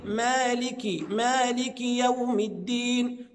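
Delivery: slow "مالك يوم الدين", maaliki with a short i; correct recitation. Shown as a demonstration. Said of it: "الصحيح الله يحفظكم يقول".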